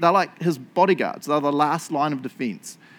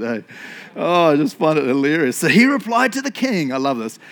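ordinary talk

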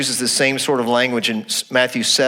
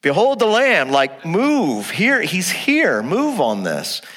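urgent John; 6 semitones higher, slower than usual. Retold or imitated. John the Baptist urging his disciples toward Jesus, mock urgent. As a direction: push them to go now. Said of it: "And then John's going".

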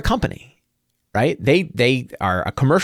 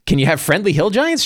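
ordinary talk

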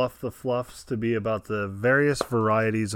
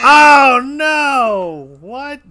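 shocked outcry; 13 semitones higher, 13 dB louder, much slower than usual. Real, not acted. Hears he got it wrong.

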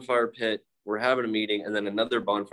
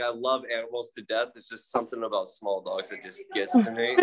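normal talking voice

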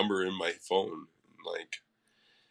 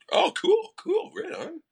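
much higher, bright mock 'cool, right on'; imitating a laid-back dude, pitched way up; mock surfer-dude voice; 3 dB louder than usual. Imitating the guy's chill reply.

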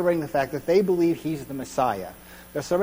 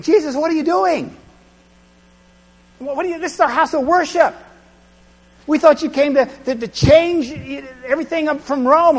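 high, loud, baffled protest; a confused follower demanding answers; bewildered worshipper; 9 semitones higher, 4 dB louder than usual. Retold or imitated. Preacher voicing a confused crowd member questioning Jesus, high and loud.

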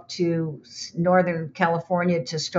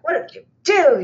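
exasperated; 9 semitones higher, 5 dB louder than usual. Retold or imitated. Andy pacing, upset.

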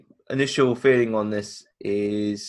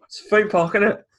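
sarcastic; 10 semitones higher, 6 dB louder than usual. Acted. Sarcastic rant about theme park.

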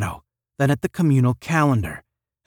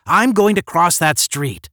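louder, higher, defiant announcement; fed up and determined; disgustedly; 7 semitones higher, 6 dB louder than usual. A narrator's reading. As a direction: raise your voice in disgusted defiance. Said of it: "Randolph said disgustedly".

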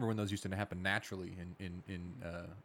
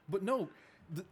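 lightly voiced small boy, higher, pressing the question; son insisting; 5 semitones higher than usual. Dad retelling his son's car question, lightly higher kid voice. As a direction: ask again like a kid who won't let it go. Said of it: "And he goes".

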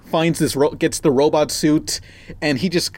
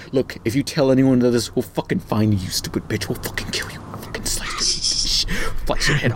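menacing whisper; 3 semitones lower, 3 dB quieter than usual. Retold or imitated. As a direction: hiss the threat quietly. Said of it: "they're saying".

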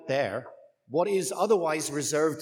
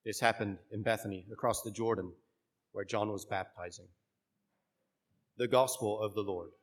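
measured liturgical proclamation; solemn church reading voice; gospel reading; 4 semitones lower, 5 dB quieter than usual. Acted.

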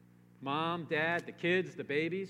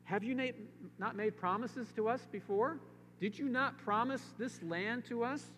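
high, pleading questions to God; pleading king; 8 semitones higher than usual. Acted. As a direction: beg God as the king would. Voicing Jehoshaphat's prayer, pleading.

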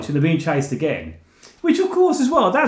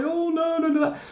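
frightened little man; 9 semitones higher, 4 dB quieter than usual. Retold or imitated. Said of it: "He goes".

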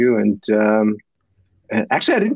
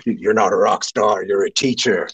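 a lower, slow, lightly voiced voice from above; voice from the sky; 5 semitones lower than usual. Recalling his first class, the sky's voice, low and slow. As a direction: let it come down like a heavenly verdict, slow and low. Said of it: "it was like the voice from the sky says".

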